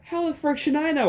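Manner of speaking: very high squeaky exasperation; exasperated retort. A narrator's reading. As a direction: squeal it in annoyed disbelief. Narrator retorts to caller.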